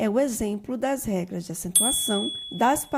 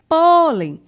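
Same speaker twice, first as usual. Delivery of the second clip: first syllable of "pólen" much louder and stretched; stress on PÓ; 6 semitones higher, 11 dB louder than usual. Shown as a demonstration. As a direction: lean hard on the PÓ in "pólen". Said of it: "o pó como sílaba tônica". (ênfase forte no PÓ de "pólen")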